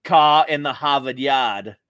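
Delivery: slow Boston accent, dropped R's. Shown as a demonstration. Co-host demonstrating the famous Boston line, Boston accent. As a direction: stretch the vowels like a Bostonian. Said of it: "it's a Boston thing".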